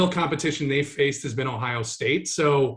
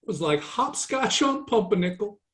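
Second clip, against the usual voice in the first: mock Southern accent; 6 semitones higher than usual. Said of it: "That's what that accent was".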